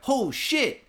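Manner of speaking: high, startled "oh shit"; realizing the quake is real. Retold or imitated. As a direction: caught off guard. Retold startled reaction.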